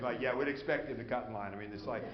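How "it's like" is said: ordinary delivery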